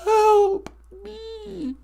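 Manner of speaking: high loud blubbering plea; mock Jussie Smollett. Fake caller pleading with the host, mock sobbing. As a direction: beg for help in a fake sob. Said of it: "Jussie Smollett is on the telephone".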